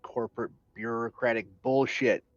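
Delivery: ordinary talk, nothing marked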